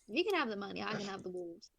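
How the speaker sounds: ordinary delivery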